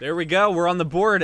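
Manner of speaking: loud, high celebration; triumphant call. Real, not acted. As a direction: call out the score to your viewers. Announcing the first goal to viewers.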